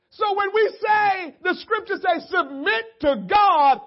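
high, loud and slow cry; preaching cry. Real, not acted. Preacher crying the command to the congregation, loud. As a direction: cry it out to the church.

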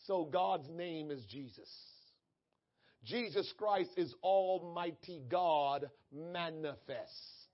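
normal talking voice

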